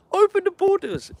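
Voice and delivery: very high-pitched mimicry; mocking slogan voice. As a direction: squeal it in mock imitation of an opponent. Mimicking political opponents' slogan.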